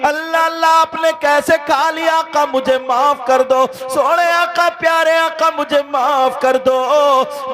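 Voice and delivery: high, loud, chanting sermon delivery; impassioned preacher singing out the story; full-force preaching. Acted. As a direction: preach with soaring, half-sung fervour. Sustained full-force preaching, half-sung.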